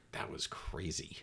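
slow hushed whisper. Retold or imitated. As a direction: breathe it out slowly, voiceless. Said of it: "the experience is just like".

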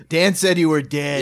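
lightly voiced blunt deadpan; flat Bull deadpan. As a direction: drop it flatly, like Bull. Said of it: "Bull just goes".

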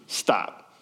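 low, breathy, clipped "Stop."; curt hushed stop. Shown as a demonstration. Samuel cutting Saul off, hushed and curt. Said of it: "that text message ... just says".

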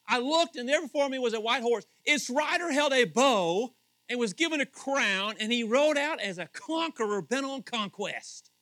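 preacher rereading the passage, loud and emphatic; emphatic reading. Shown as a demonstration. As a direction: proclaim the vision boldly. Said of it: "It's exclamation point".

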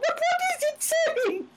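confused husband. Retold or imitated.

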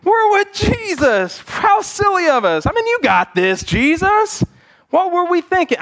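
high, mock sheepish realization; a hammed-up "oh, right" from the disciples. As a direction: play the embarrassed disciples catching on. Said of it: "slapped their head ... and said".

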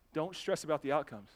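normal talking voice

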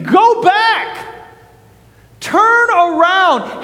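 high, urgent cry with little added volume; mock scream. Preacher voices Scripture warning drifters from the shore, urgent high call. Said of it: "and it's screaming".

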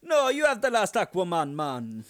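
playful Jamaican impression, generous; Jamaican accent. Cohost answers in a joking Jamaican accent. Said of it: "finish it up on Jamaican Aquaman".

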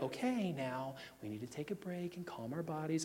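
soft, hushed soothing-parent voice; mock calm parent. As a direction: imitate a gentle parent calming a bratty kid. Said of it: "be like".

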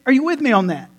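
ordinary talk, nothing marked

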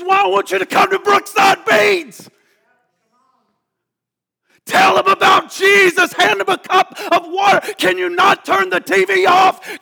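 loud, high fire-and-brimstone preaching; preaching at full force. Acted. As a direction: thunder it at the congregation.

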